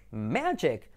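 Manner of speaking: "magic" slow, MEH-jek; pronunciación correcta. Shown as a demonstration. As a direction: draw out "magic" with short e vowels, hard j. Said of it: "haces la I como una E". ("magic" lento, «meee-dchek»)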